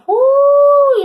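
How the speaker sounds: a high, loud whoop of delight; sudden burst of joy at the card; excited whoo. Real, not acted. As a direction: let out a thrilled whoo. Thrilled by the card.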